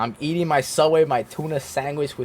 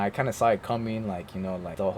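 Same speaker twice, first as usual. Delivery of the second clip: normal talking voice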